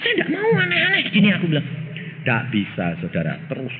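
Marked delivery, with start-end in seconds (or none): 0.0-1.3 startled question. Retold or imitated. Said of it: "Saya bilang".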